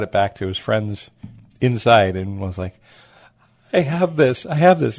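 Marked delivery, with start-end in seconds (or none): 3.7-5.0 higher and louder, thrilled repeat; excited bragging. Retold or imitated. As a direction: crow it like you can't believe your luck. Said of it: "almost out of breath because he's so excited".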